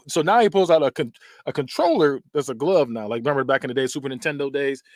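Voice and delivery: ordinary talk, nothing marked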